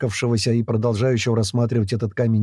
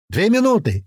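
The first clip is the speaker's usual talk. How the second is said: high countdown call; urgent high shout; yelling; 9 semitones higher than usual. A narrator's reading. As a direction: holler the time left, pitch well up. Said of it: "это уже Клёп орёт".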